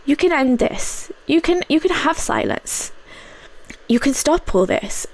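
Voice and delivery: gently tempt, like a voice in the head; coaxing inner voice. Retold or imitated. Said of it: "that voice came back".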